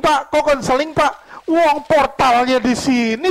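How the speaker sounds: high, ringing pulpit delivery; preaching at full force. Real, not acted. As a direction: drive each question home to the congregation. (khotbah berapi-api dengan suara lantang)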